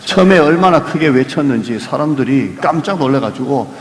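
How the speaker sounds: normal talking voice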